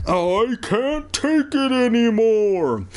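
high, drawn-out theatrical wail; screaming. A narrator's reading. Evan exploding at the closing police, high drawn-out scream. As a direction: stretch it into a slow, high cry. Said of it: "he screamed".

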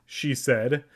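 plain reading voice

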